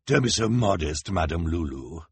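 mock-kind villainous coaxing; nasty smile. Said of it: "said with a nasty smile".

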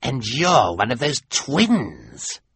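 slow villain voice; lightly voiced villain; sly Olaf. A narrator's reading. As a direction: accuse the boy. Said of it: "Olaf said to Quigley".

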